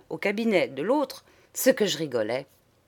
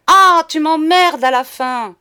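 high, very loud burst of fury; coarse washhouse yell; 7 semitones higher, 10 dB louder than usual. A narrator's reading. Madame fed up with monsieur, coarse angry yell. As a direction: spew it out like a fishwife. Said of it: "vomit madame d'une voix de lavoir".

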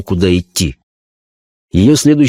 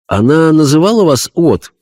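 high, earnest medium voice; a spirit medium describing a vision; seance medium; 7 semitones higher than usual. Acted. Medium asks. (голос медиума на сеансе)

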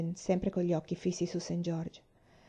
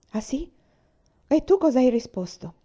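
strained high voice trying to seem casual; forcing a normal tone and failing; failing to sound natural; 7 semitones higher, 6 dB louder than usual. Acted. Ellen to her sister, failing to sound natural, strained. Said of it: "tentando di mantenere un tono di voce normale e naturale e fallendo".

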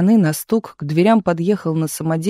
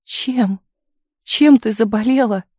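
tearful; 5 semitones higher, 4 dB louder, slower than usual. A narrator's reading. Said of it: "плакала Таисия".

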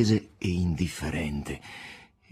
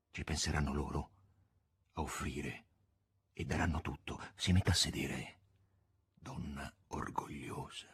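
much softer, sinking to a whisper; hushed; 6 semitones lower, 12 dB quieter than usual. Acted. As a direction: lean in and let it fade to a whisper. Voland quietly instructs Margherita. (sussurrando, sempre più piano)

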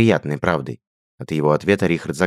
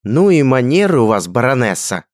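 higher, louder theatrical indignation; hissed rebuke, too loud; 7 semitones higher, 7 dB louder than usual. A narrator's reading. Richard hisses at Radgar over his foot as music stops. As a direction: scold him dramatically. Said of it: "прошипел Рихард громче положенного".

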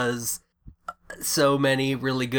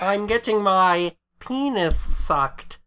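higher, slow nasal Doctor Evil; 6 semitones higher, 3 dB louder, slower than usual. Retold or imitated. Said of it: "a little nasal voice and talk like this".

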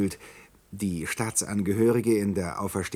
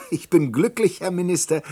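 laughing gloat; 6 semitones higher, 6 dB louder than usual. Acted. Gloats at the minister.

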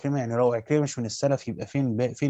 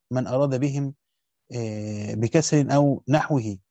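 normal talking voice

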